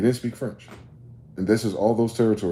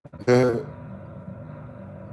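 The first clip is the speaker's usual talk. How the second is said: scornful laugh; 3 semitones higher than usual. Real, not acted. Laughs at own point.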